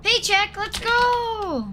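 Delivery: high gleeful celebration; excited cheer. Real, not acted. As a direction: whoop over the payday. Celebrating payout.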